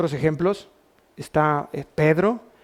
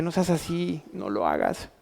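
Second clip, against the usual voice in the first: soft pleading; 8 dB quieter than usual. Acted. King pleads with Daniel.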